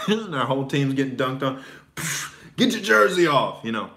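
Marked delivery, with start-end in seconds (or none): 1.9-3.6 high, loud scornful jeer; mocking trash talk. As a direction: taunt the losing team. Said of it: "trash talk on the court".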